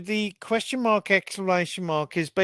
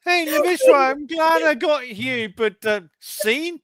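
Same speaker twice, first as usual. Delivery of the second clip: higher, louder teasing voice; mock address; 7 semitones higher, 3 dB louder than usual. Cohost voicing someone teasing the host, higher and louder. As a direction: put on a squeaky jokey voice. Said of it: "It's like".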